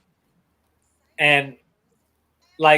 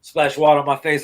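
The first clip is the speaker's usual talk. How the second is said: ordinary delivery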